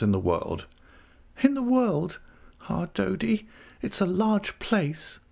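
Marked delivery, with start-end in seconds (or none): 1.4-2.2 put-on very high, girlish questioning echo; Dora. A narrator's reading. Wife echoes husband in character. 2.7-5.2 put-on high, slow-ending girlish voice; Dora. A narrator's reading. Wife answers husband in character.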